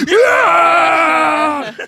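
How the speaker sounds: high, loud cheering shriek; excited yell. Retold or imitated. Imitating Dee Dee cheering in the theater, shrill yell. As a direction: scream like a fan at the movie. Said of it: "Dee Dee".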